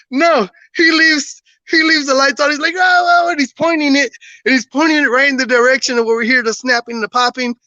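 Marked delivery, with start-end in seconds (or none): 2.7-3.3 high mock-panicked cries; freaking-out friend. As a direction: imitate a panicky friend, pitched up. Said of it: "One of my friends is freaking out".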